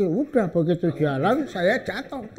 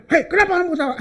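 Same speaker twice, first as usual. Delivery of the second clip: angry soldier; 11 semitones higher, 3 dB louder, much faster than usual. Retold or imitated. Soldier scolds again, retold.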